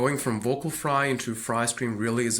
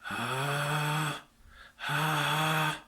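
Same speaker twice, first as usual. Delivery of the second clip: whisper scream; 5 semitones higher than usual. Shown as a demonstration. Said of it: "with more of a whisper scream inside".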